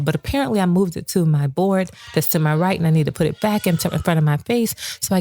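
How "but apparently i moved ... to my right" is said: usual speaking voice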